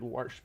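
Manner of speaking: warsh with an r before the sh; dialect pronunciation. Shown as a demonstration. Streamer naming a hated local form, wash said as "warsh". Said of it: "I've always hated warsh".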